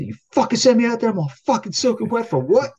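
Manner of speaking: high, loud furious rant; retold furious outburst at work; going off. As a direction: blow up at the whole shop. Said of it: "I'm going off like".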